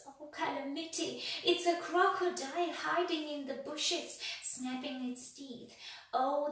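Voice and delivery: high, loud frantic wailing; panicked. Acted. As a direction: babble in rising terror. Mister Jelly panics again.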